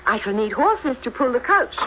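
ordinary talk

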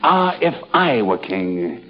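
low, slow romantic English-accented acting. Shown as a demonstration. Host demonstrating a love scene to Lady Parkington, English accent. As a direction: woo her in a stagy English accent, unhurried. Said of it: "Look, I'll show you".

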